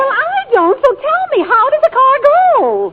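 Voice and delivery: character voice getting shrill with impatience; insistent demand. Acted. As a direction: demand an answer, exasperated. She repeats question, insisting.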